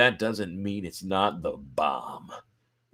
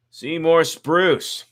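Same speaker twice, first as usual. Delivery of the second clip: resentful greeting; 7 semitones higher, 7 dB louder, much slower than usual. Acted. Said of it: "rival greeting".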